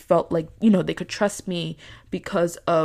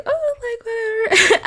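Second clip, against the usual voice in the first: coy mock whatever; 5 dB louder, slower than usual. Coy reply on the couch.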